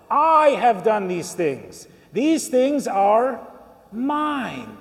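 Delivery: slow, heavy stress on "I" and "mine"; emphatic self-claim. Retold or imitated. Preacher quotes the rich man talking to himself, slow and emphatic. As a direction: stretch "I" and "mine" for the congregation.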